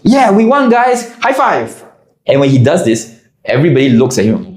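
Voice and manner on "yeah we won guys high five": much higher, upbeat mimic of Y; pitched-up cheerful imitation of Y; mock cheer as Y. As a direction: celebrate obliviously in his voice. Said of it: "turns to all of us and goes".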